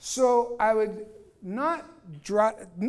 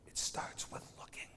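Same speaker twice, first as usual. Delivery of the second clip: whispered; 16 dB quieter than usual. Shown as a demonstration. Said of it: "repeated as a whispered echo".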